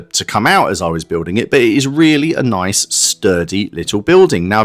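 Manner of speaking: normal talking voice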